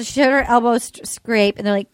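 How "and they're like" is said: ordinary talk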